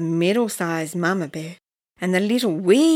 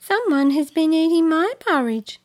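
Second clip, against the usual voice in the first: mama bear voice; 8 semitones higher, 4 dB louder than usual. Acted. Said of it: "said the middle-sized Mama Bear".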